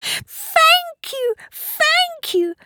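put-on very high child squeal; grateful little girl. A narrator's reading. Young Polly receives her bear, squealing gratefully. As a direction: squeal thanks in a tiny high voice.

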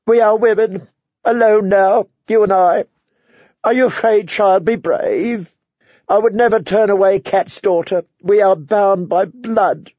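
a hint of a grieving aunt pleading closeness; lightly voiced aunt Lysa. A narrator's reading. Aunt Lysa speaks to Sansa.

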